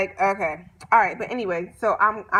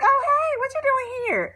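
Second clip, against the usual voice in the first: much higher, squealing mock delight; flirty surprise; 14 semitones higher than usual. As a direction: act thrilled to see the trainer. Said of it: "she like".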